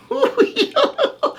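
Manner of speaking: very high witchy chuckle; disguised wizard cackling; high cackle. Acted. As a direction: let out a squeaky cackle. The old washerwoman chuckled.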